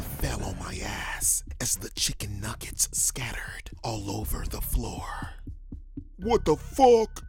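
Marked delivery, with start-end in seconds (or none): 6.2-7.2 much higher, loud furious snap; angry outburst. Acted. Mobster yelling at the manager who dropped his food. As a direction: explode at the fallen tray. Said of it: "he said angrily".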